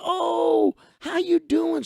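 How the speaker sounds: high-pitched, exuberant hello; delighted greeting. Retold or imitated. Man greets the woman he knows, excited greeting. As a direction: imitate gushing at someone you just recognized.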